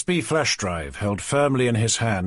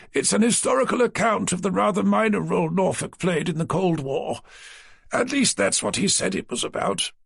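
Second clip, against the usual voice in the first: higher, fussy publisher's voice; a prim, slightly pompous office man; publisher Naylor; 8 semitones higher than usual. A narrator's reading. Naylor's voice in the story.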